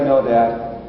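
low, matter-of-fact little answer; deadpan child. Retold or imitated. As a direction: imitate an unbothered kid. Daughter answers father matter-of-factly.

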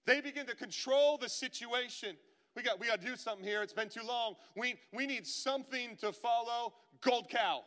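loud, high, hurried crowd voice; mock-urgent crowd demanding a golden calf; impatient Israelites. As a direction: clamour like a restless mob. Acting out impatient Israelites demanding idol.

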